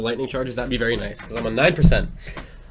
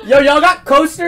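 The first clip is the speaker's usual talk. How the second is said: high-pitched shouted question; calling out; 12 semitones higher, 4 dB louder than usual. Real, not acted. Co-host asking the others for coasters, calling out. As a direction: holler it to people farther away.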